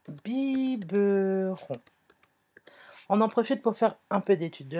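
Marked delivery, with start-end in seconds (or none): 0.1-1.7 biberon drawn out slowly, bi-be-ron; syllable by syllable. Shown as a demonstration. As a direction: stretch each syllable of biberon: biii-beu-ron. Answer given syllable by syllable. (biberon dit lentement, syllabe par syllabe)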